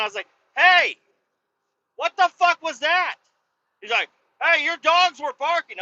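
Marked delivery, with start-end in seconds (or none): 0.6-3.2 high, loud indignant yell; angry confrontation. Retold or imitated. Dog owner confronting neighbor over the air horn, angry yell. 4.4-5.8 high-pitched, raised excuse; mock the neighbor arguing back; defensive neighbor. Neighbor excusing his honking to the dog owner, defensive.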